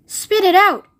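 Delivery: sharp high order; urgent command. A narrator's reading. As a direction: order her to spit. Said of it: "said Mister Wonka".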